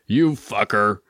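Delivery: understated angry yell, kept at reading volume; mild narrated yell. A narrator's reading. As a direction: curse him with a restrained yell. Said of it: "curse loudly and then yell even louder".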